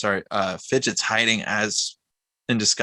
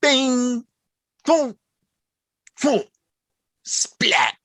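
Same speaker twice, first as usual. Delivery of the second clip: punchy spoken impact noises, spaced out; bing high and loud, splat whispered; cartoon sound effects; 14 semitones higher, 3 dB louder, much slower than usual. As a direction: voice each hit like a comic-book sound effect. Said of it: "do the sound effects".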